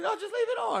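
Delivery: squeaky put-on voice for her urging; mock woman's voice.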